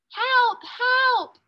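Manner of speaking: high, loud cries for help; desperate high yell for help; calling for help. Acted. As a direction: shout it up from the bottom of a pit. Trapped boy calling for help.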